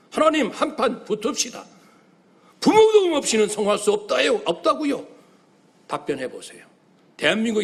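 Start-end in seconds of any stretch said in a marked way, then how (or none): 0.1-5.1 high, loud, fast demanding cry; desperate crying out. Acted. Young man challenging God to answer him. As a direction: challenge God head on, pushing hard. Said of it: "살기를 포기하고 부르짖어라".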